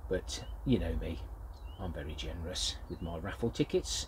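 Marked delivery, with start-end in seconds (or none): none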